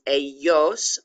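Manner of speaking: careful "Ellos", with a y sound in the middle; pronunciation demo. Shown as a demonstration. Teacher showing the double L, careful demo. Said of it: "it sounds like a Y".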